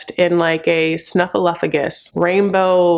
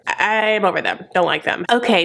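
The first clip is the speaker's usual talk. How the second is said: ordinary talk, nothing marked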